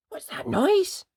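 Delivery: high, alarmed small voice; startled little bunny. Acted. Peter startled awake by the owl, high and scared. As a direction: ask it like a frightened small rabbit. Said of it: "said Peter".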